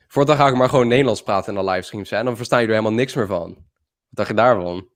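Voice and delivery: rapid-fire Dutch; speaking Dutch. Real, not acted. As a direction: rattle it off in fast Dutch. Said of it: "just gonna talk Dutch".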